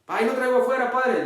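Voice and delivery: cheeky thief. Pretend. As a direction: deliver the punchline like a proud thief. Thief answers priest in joke.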